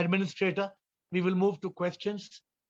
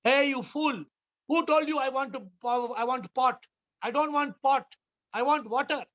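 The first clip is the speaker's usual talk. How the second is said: Swami shouting; 5 semitones higher than usual. Retold or imitated. Swami shouting at the student who brought a pot. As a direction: bellow at a foolish pupil. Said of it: "the Swami starts shouting".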